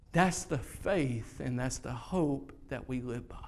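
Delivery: ordinary talk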